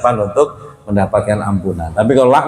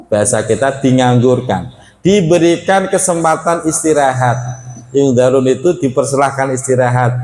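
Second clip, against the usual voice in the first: usual speaking voice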